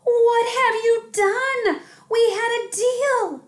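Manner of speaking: high, loud, slow outraged shout; furious. A narrator's reading. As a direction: scold him at full volume. Mom furious at broken deal.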